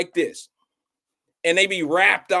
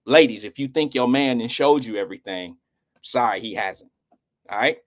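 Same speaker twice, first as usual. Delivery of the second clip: usual speaking voice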